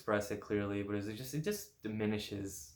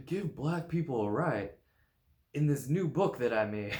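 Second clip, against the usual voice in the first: imitating Martin Luther King; 5 semitones higher, 6 dB louder than usual. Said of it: "Martin Luther King imitation".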